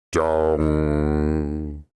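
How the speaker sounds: deep, long bell tone; lowest voice. Shown as a demonstration. Showing his mate his deepest bell-like voice. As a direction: sink as low as you can. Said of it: "as low as I can get my voice".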